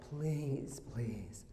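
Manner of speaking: soft pleading, the second please whispered; a hint of a worried mother begging in a hush. Mother pleading before he left.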